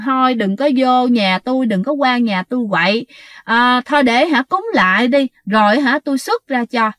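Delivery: usual speaking voice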